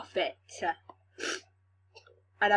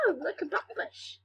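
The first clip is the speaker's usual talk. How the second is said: high, softer character voice; soft high-pitched little voice; voice of the thing; 6 semitones higher, 8 dB quieter than usual. Acted. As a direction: say it high and quieter, marvelling. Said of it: "the thing goes through like".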